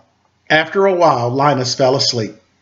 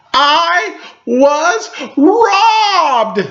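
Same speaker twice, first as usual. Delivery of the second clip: very slow, high, drawn-out cry of outrage; outraged shout; 14 semitones higher, 3 dB louder, much slower than usual. A narrator's reading. Sally furious at Linus after missing Halloween, drawn-out outraged cry. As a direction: wail it like a cheated kid. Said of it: "she shouted".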